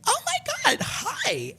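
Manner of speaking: squealed, very high-pitched hello; thrilled, sky-high greeting; excited greeting. Retold or imitated. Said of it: "I'm just so excited to see".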